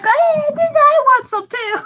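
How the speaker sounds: high, slow, put-on sing-song voice; squeaky voiced request. Player character sweetly asking the tiefling for some too. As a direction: ask sweetly in a silly high voice. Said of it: "I'm gonna be like".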